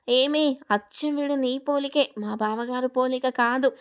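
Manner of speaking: usual speaking voice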